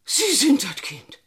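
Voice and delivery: a high, stunned cry; shocked disbelief. Acted. Landlady to the visitor, stunned disbelief. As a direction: she can't believe who stands there.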